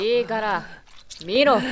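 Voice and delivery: loud barked order; forceful shout; sharp command. Acted. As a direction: make him look. Forcing him to look at wound.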